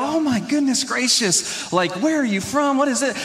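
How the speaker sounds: mock amazed customer, pitched high; delighted customers. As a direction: gush with high, imitated excitement. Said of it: "their faces would light up".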